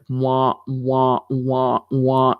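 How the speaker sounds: low muffled wah wah drone; mock adult drone, wah wah; Charlie Brown teacher wah-wah. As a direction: mimic the Peanuts teacher trombone voice. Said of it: "Charlie Brown teachers".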